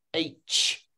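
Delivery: H said as one "aitch" ending in ch; sounds joined. Shown as a demonstration. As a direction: blend A, T, Ch into H. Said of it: "Try to say it together".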